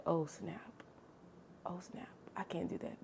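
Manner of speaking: hushed, almost whispered alarm; panicked whisper. Retold or imitated. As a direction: breathe it out in a private panic. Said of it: "And I'm like".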